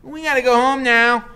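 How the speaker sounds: chattery high. Acted. Scared boy declining the invitation, chattery high. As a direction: babble politely in fear. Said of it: "his voice is chattery high".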